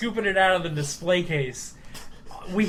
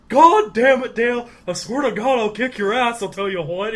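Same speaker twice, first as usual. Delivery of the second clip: louder, higher mock outburst at Dale; angry Hank; 4 semitones higher, 3 dB louder than usual. Narrator as Hank scolding Dale, higher and louder. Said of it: "I go".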